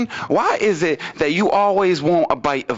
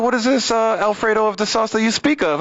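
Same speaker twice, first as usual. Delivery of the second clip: higher-pitched mock-exotic girlfriend voice; puzzled foreigner act; 6 semitones higher than usual. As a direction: act baffled in oddly formal speech. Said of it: "she started talking in another language".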